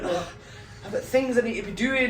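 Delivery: mildly put-on impatient complaint; mock moaning. Imitating audience members fed up with a late start, moaning. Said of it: "Not one single person was moaning".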